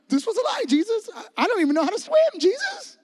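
high, frantic squeal pleading with Jesus; panicked disciple. Acted. Disciple in the sinking boat crying out to Jesus in high panic. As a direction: whine like you're about to drown.

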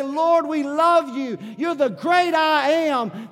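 slow, loud, high cry of worship; shouted praise. Real, not acted. Pastor leading the church in praise, slow shout. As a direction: lift it up to God, slow and loud.

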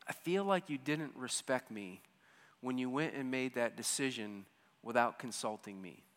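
wife calmly telling her husband how she felt; calm, direct. Retold or imitated. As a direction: say it gently and directly.

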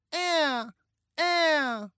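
put-on high, drawn-out toddler wail; loud, high baby cry; baby's wail. A narrator's reading. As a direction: wail one syllable like a small child. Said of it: "wailed a gibberish that sounded like".

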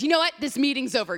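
imitating the producer storming off; mock angry outburst. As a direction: snap it like ending the meeting.

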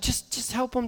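imitated wife, very soft; hushed urging. Wife pressing dad to help crying kids, hushed. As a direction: nudge him quietly and insistently. Wife quietly intervenes in story.

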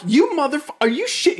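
high, loud outraged yell; mock outrage. To his dad through the door, high outraged yell.

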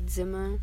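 Zimmer with Z as TS; German-style "Zimmer", TS at the front; German pronunciation. Shown as a demonstration. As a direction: begin "Zimmer" with a TS sound. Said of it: "Z makes a T S sound".

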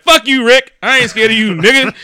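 hammed-up fighting words; defiant mock yell. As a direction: square up to him loudly. Acted standoff with Rick.

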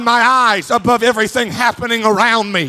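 preaching at full force. Acted. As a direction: lift the congregation, preach it out. Preacher swells again.

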